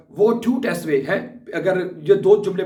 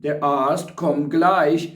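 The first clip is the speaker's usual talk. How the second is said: very slow, every German word spelled out for learners; slow dictation; much slower than usual. Shown as a demonstration. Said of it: "वो फिर कह सकता है".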